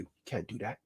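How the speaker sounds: hushed, low taunting inner voice; inner voice whisper. Retold or imitated. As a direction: murmur it like doubt in your head. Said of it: "that inner voice that's gonna whisper to you".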